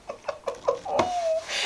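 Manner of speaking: a high burst of giddy laughter. Real, not acted. Laughing high and giddy at the bargain. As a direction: laugh out, thrilled by the deal.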